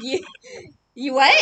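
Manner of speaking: loud question through real laughter; laughing disbelief. Real, not acted. Host retells asking friend what he just confessed, laughing disbelief. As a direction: blurt it out, cracking up. Cracks up retelling shocked reply.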